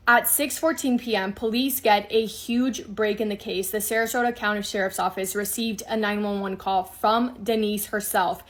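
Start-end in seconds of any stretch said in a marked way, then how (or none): none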